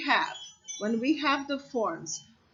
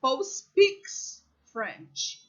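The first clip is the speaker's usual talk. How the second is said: slow model sentence; much slower than usual. Shown as a demonstration. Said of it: "you say".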